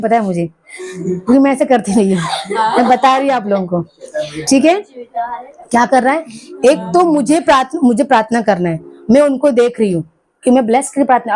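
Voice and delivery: ordinary talk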